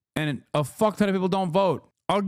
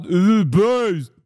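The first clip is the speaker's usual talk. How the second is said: slow, loud, dopey voice; mock dopey fan; 5 semitones higher, 6 dB louder, much slower than usual. Mocking Asmongoldians to chat in a slow, loud dopey voice. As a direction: stretch it out, playing the dim fan. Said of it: "Asmongoldians who thought".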